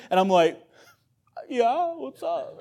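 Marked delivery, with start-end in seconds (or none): 1.5-2.6 very high, slow blubbering reply; hammed-up tearful voice, played for laughs; mock bawling. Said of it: "I'm bawling my eyes out".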